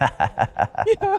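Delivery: a sudden very high "Yo" squeal; excited yelp. Real, not acted. Streamer shocked by the accent clip, high squealed Yo. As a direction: yelp it out in high-pitched disbelief.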